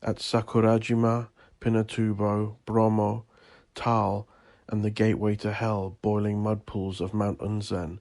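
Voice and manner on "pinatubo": ordinary delivery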